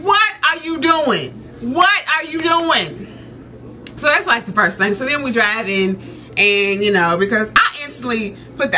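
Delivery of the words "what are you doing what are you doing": high-pitched, drawn-out disbelief; exasperated demand. Retold or imitated. Daughter to her dad speeding up to block a car, exasperated. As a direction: demand it word by word, appalled.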